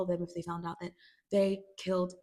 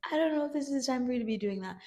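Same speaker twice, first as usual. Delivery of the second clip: Rue voice; 5 semitones higher, faster than usual. Retold or imitated. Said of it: "Rue is like".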